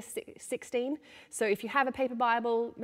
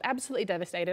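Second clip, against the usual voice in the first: usual speaking voice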